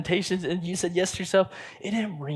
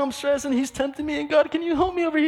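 high, fast, whining plea to God; a stressed believer whining for help, much higher and quicker; whiny believer; 13 semitones higher, 5 dB louder, faster than usual. Retold or imitated. Believer complaining to God, whiny, high and fast.

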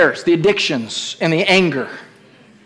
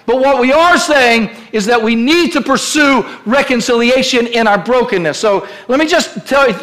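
usual speaking voice

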